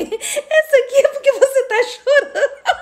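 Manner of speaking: high, slow imitation dissolving into laughter; drawn-out punchline through giggles; daughter's correction, laughing. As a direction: imitate the daughter while cracking up. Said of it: "Aí ela falou".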